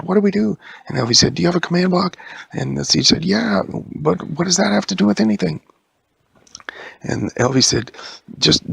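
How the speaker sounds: high, loud and hurried; frantic. Acted. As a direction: rush it in panic. Frantic chase dialogue.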